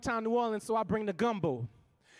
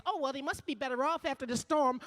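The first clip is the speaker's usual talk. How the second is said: mocking Barbara Bush; 5 semitones higher than usual.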